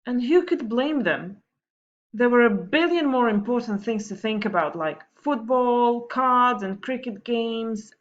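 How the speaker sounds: put-on lively read-aloud voice for children; story reading. Teacher reading a funny story to her pupils, lively storytelling. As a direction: tell the story brightly to the class.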